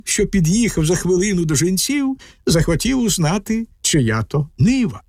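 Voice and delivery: ordinary delivery, nothing marked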